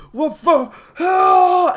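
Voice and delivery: high, drawn-out villain scream; acted death cry; panicked scream. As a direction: stretch it into a loud, high yell.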